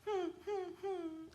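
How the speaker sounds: soft, high little whimpers; small high whines before crying; puppy-like whimper. Shown as a demonstration. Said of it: "like, almost like a puppy".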